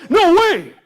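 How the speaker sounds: loud, high, flat refusal; Farmer Brown refusing. Acted. Farmer refusing the cows their blankets, loud. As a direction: shut it down hard. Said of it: "said Farmer Brown".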